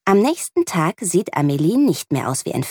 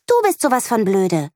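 put-on higher, childish retort; girl insulting her friend back, a bit higher; snapping back; 5 semitones higher than usual. A narrator's reading. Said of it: "faucht Lea zurück".

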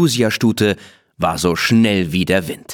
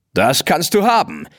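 higher, cocky acceptance; neighing reply; 8 semitones higher than usual. Acted. Blackie accepting Rose's race, cocky and higher. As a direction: toss back the challenge like a proud horse. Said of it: "wieherte Blackie".

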